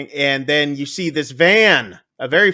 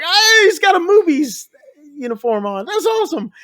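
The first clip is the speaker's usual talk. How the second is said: excited exclamation; 12 semitones higher than usual. Retold or imitated. Kevin Smith fan spots a Movies uniform, excited. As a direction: act out thrilled recognition, voice shooting up. Excited reaction to spotting a costume.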